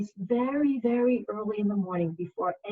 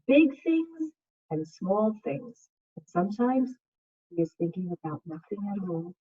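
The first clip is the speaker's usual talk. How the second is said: put-on reading to children; 7 dB louder than usual. Second grade teacher reading a picture book to her class, soothing.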